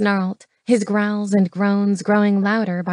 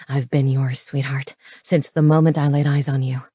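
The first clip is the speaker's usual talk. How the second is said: low-pitched, devoted lover; low voice, intimate declaration; devoted male lover; 6 semitones lower than usual. Acted. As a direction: voice the man low, pledging himself.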